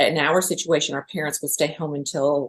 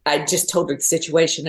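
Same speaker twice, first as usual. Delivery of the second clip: ordinary delivery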